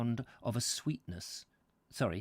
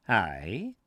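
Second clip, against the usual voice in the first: low, solemn Scottish aye; solemn priest with a Scottish accent; 4 semitones lower than usual. A narrator's reading. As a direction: give a grave Scots assent. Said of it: "he said solemnly".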